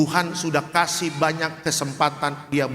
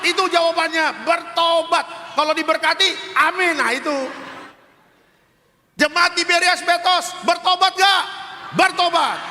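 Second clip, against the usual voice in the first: fiery preaching; 12 semitones higher than usual. Acted. Pastor preaching hard to the congregation, fiery.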